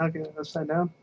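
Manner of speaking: usual speaking voice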